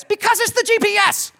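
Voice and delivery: very high, loud mock shriek; freaking out. Wife defends trusting the GPS, freaking out. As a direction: imitate a frantic, exasperated outburst. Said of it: "And she's freaking out".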